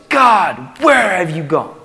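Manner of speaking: high, loud, anguished cry for help; desperate plea. Retold or imitated. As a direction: wail to God about the enemies. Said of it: "And next Psalm, it's".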